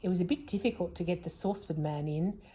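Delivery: plain reading voice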